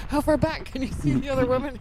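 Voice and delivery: high, quick asking; eager question. Retold or imitated. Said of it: "I asked the camera runner".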